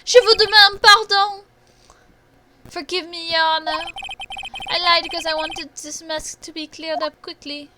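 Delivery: put-on high French accent, bursting out loud first. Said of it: "French accent's still on point".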